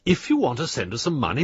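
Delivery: ordinary talk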